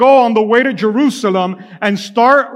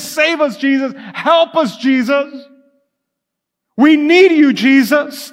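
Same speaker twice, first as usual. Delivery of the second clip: pleading crowd; 6 semitones higher, 4 dB louder than usual. Acted. Preacher voices the pleading crowd.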